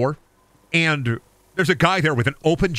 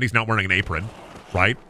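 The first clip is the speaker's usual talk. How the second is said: ordinary talk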